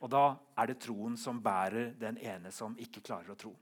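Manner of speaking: usual speaking voice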